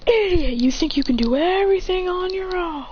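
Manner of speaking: high, strained anime taunt; mock-indignant rival, pitched high; acted taunt. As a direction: throw the insult out high-pitched.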